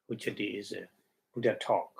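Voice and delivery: usual speaking voice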